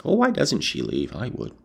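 plain reading voice

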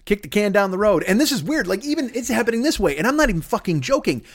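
usual speaking voice

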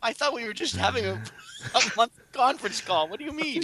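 high, feigned astonishment; mock surprise. Joking he didn't know it's a show.

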